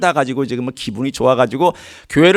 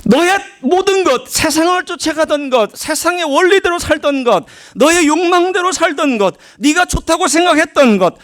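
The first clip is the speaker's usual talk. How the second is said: fervent command; 13 semitones higher, 3 dB louder than usual. Acted. Preacher voices God demanding believers give up everything, high and loud. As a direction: proclaim each line as God's demand.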